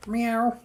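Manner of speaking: high mock meow; a quick imitated meow; cat meow. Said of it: "meowing at the cat".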